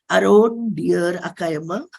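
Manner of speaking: normal talking voice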